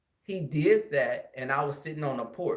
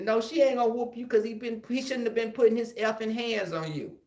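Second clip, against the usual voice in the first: high, pushy auntie voice; fierce aunt; 7 semitones higher than usual. Retold or imitated. Aunt telling her nephew to hit the bully back. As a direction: urge him to fight back. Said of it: "And she said".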